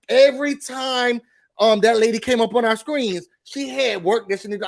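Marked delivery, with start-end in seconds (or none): none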